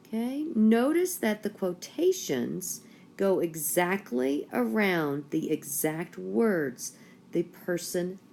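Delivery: ordinary talk, nothing marked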